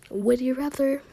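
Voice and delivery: usual speaking voice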